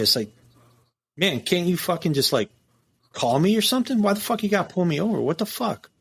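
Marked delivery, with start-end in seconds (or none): 1.2-5.9 higher, fast, exasperated complaint; heated retold rant. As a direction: vent at the agent who pulled you over.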